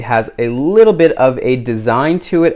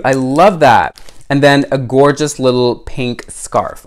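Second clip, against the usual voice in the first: ordinary talk, nothing marked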